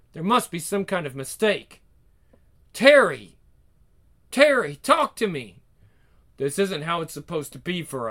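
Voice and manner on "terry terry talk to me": loud, pleading call after her. Acted. Husband calls after his wife, begging her to talk. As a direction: shout her name to stop her leaving.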